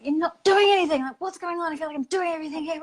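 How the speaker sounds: high, loud, fast rant at her husband; nagging complaint. Retold or imitated. Wife nagging her husband about chores, high fast rant. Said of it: "when I was nagging him".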